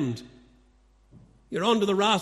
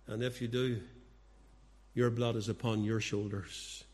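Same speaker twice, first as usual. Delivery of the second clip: much softer and lower, grave and deliberate; solemn hush; 7 semitones lower, 7 dB quieter than usual. Real, not acted. Preacher warning the unrepentant, hushed solemn gravity. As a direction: drop to a grave near-whisper of warning.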